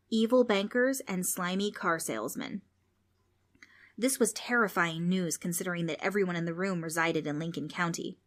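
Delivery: ordinary delivery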